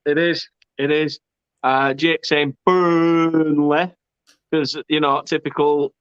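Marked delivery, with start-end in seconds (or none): 2.7-3.9 "Burnley" with a long, stretched first syllable; mocking drawn-out Burnley. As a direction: stretch the "Bur" of "Burnley" in mockery. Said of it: "why do you have to take the piss".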